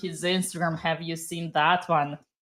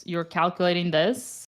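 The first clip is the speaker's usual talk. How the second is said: ordinary delivery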